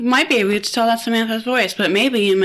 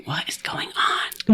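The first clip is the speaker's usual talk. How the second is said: slow, bewildered whisper; hushed, disbelieving whisper; 4 semitones lower, 6 dB quieter, slower than usual. Retold or imitated. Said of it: "And I was just like".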